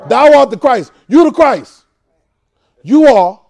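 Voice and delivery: punchy preacher's exclamation; emphatic declaration. Acted. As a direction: proclaim it like a revelation. Acting out Peter's emphatic answer.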